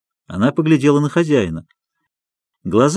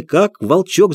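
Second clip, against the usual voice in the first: ordinary delivery, nothing marked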